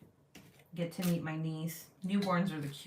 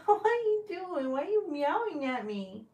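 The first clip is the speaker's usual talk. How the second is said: pet talk; 10 semitones higher than usual. Real, not acted. Asking her cat why it meows, in high playful baby talk. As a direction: tease the cat in a high sing-song.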